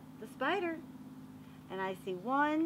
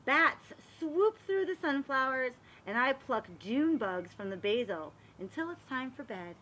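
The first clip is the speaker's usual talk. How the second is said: storybook narration; 3 semitones higher, 3 dB louder than usual. Acted. Teacher reading a garden book aloud to the class, storybook voice. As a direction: read it like a picture book for little kids. Reads picture book to children.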